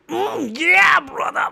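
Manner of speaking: repeating his own pumped-up shout; hype voice. Retold or imitated. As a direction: belt out the bro hype again. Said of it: "I make a voice like that".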